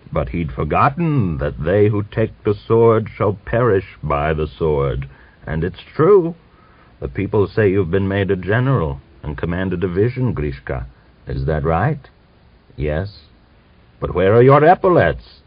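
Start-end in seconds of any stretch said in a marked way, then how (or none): none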